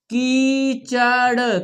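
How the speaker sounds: कीचड़ très lent, syllabes étirées; épelle कीचड़ syllabe après syllabe; lecture syllabée. Shown as a demonstration. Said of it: "हमारे साथ पढ़ना है आपको".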